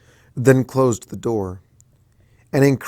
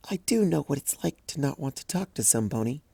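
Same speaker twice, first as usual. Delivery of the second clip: soft, shy pony voice, a little higher; hushed and hesitant timid mare; timid Fluttershy; 3 semitones higher, 7 dB quieter than usual. Acted. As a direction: speak softly like a bashful pony.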